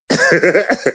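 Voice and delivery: chuckling in mockery; short scoffing chuckle; derisive laugh. Real, not acted. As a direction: laugh at the man's claim. Mocking another man's claim.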